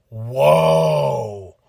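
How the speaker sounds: low, long stunned whoa; drawn-out low amazed whoa; awed whoa. Retold or imitated. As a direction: let it sink in, amazed. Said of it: "I was like".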